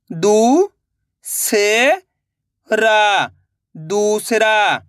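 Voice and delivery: slow classroom phonics drill; syllable-by-syllable reading. Shown as a demonstration. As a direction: spell each syllable apart with pauses, then say the whole word. Teacher reads syllables then whole word.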